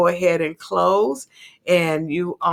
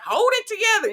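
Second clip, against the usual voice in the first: imitating a mom, much higher, firm; stern mom; 13 semitones higher than usual. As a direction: mimic the mom who tells you to hold it together. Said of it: "she'd be like".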